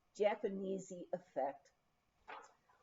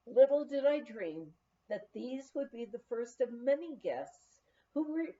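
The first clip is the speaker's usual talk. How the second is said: ordinary delivery, nothing marked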